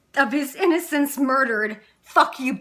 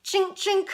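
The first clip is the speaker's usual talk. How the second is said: two high spoken clinks; clinking metal sound; 8 semitones higher than usual. Acted. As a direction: imitate metal clinking, pitched well up.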